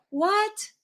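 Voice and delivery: sudden high disbelieving what; genuinely shocked, the pitch jumps way up; incredulous what. Real, not acted. Vlogger reacting to the deleted documents, shocked disbelief.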